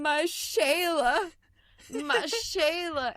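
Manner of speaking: high, slow, shouted meme quote. Retold or imitated. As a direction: wail it out like the meme. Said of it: "I'm quoting".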